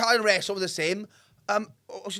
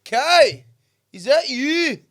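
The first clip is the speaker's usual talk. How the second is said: raised, loud calling voice; voice from outside; 5 semitones higher, 8 dB louder, much slower than usual. Retold or imitated. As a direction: call out to a mate through a door. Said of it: "I hear a voice in".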